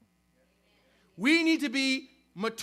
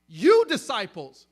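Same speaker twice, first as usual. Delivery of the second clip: YOU hit loud and drawn out, then disciples; loud, deliberate stress on YOU; emphatic YOU; 2 semitones lower, 10 dB louder, slower than usual. Retold or imitated. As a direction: lean hard and slowly on YOU. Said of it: "it's emphatic".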